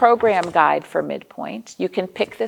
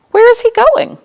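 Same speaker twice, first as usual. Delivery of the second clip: excited question; 11 dB louder than usual. Acted.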